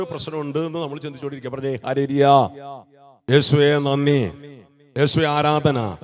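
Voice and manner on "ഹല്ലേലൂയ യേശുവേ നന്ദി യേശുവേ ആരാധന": slow ringing call of thanks to Jesus; loud praise. Real, not acted. Preacher calling out praise to the congregation, loud and slow. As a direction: lift it up, praising aloud.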